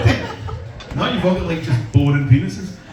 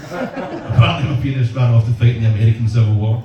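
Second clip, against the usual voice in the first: ordinary talk, nothing marked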